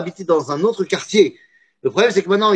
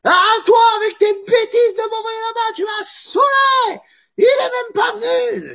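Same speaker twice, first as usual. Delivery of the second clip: very high, shrieking furious shout; screaming rage. Retold or imitated. Husband screams at his wife, very high furious shouting. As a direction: scream at her with everything. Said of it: "qui est très énervé".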